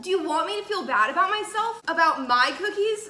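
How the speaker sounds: high, loud playful protest; hammed-up offended outburst; mock indignation. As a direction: act wounded, pitch up, half laughing. Mock quarrel over mom's bought cookies.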